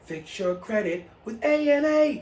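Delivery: high, slow mock ad announcer; commercial voice. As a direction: sell the slogan in a TV-ad voice. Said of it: "if we had a commercial".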